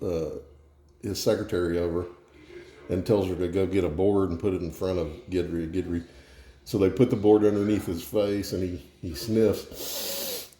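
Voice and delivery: ordinary talk, nothing marked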